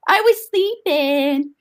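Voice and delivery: put-on high, slow baby talk to the dog; coaxing the dog. Owner cooing to her sleepy puppy in baby talk. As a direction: coo at the puppy, stretching it out.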